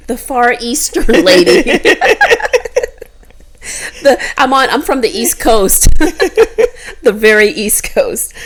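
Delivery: giggling out the joke, voice high and loud; laughing through words. Real, not acted. Mocking the Oriental label to her friend, laughing. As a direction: laugh while you say it, half breaking up.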